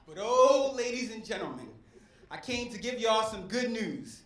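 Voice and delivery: ordinary talk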